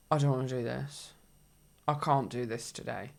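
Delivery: low, quiet morning resignation; imitating a defeated inner voice; weary dread. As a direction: sigh it out, low and soft. Voicing weary morning thought.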